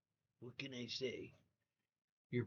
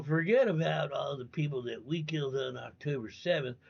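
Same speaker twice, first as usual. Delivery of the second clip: ordinary talk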